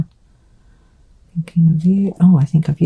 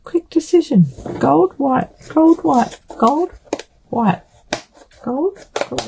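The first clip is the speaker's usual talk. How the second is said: high rapid back-and-forth; excited dithering; 11 semitones higher, slower than usual. Pretend. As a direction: chant the choices in a high voice.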